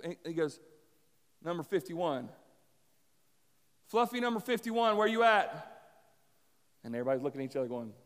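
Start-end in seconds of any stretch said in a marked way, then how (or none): 1.4-5.7 calling out. Retold or imitated. Shepherd calls out for lost sheep.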